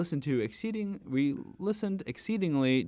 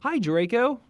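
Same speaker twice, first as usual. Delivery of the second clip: bright, higher and louder hello; cheerful greeting; 9 semitones higher, 7 dB louder than usual. Shown as a demonstration. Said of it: "No, oh, sorry".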